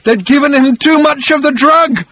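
very high, full frantic shout. A narrator's reading. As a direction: yell it out in desperation. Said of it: "and shouted at them".

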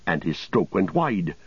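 ordinary delivery

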